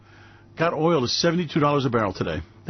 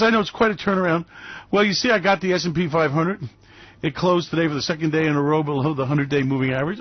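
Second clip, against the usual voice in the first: ordinary delivery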